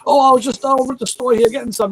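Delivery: high, innocent put-on customer; mock walk-on customer explaining himself. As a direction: play the stranger with a bright excuse. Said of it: "He goes".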